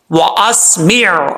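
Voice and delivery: "وَأَسْمِعْ" slowly, ending in a throaty ain; final ain sounded. Shown as a demonstration. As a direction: hold the ain closing "وَأَسْمِعْ". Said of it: "تب بھی عین کا sound آئے گا". ("وَأَسْمِعْ" آخر میں عین کی واضح آواز)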